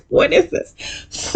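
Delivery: whispered, slow and baffled; breathy mock whisper. Guests puzzling over the fan, slow whispered bafflement. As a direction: hush it out in confusion. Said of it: "Everyone's like".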